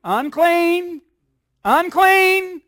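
high, loud cry of "Unclean!"; leper shouting unclean. Acted. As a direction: call out the warning like an outcast leper. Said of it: "he would have to shout".

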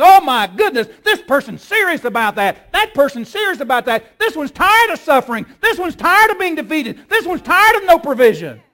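a hint of a panicked devil, high and loud; frantic devil. Devil alarmed at serious prayer, frantic loud voice. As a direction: play the devil getting rattled. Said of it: "he gets all in a frantic".

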